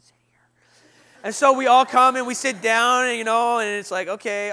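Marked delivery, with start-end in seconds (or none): 0.0-0.4 hushed church whisper; barely audible whisper; whispered invitation. Retold or imitated. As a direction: whisper it discreetly to a pew neighbour. Lady whispers in service.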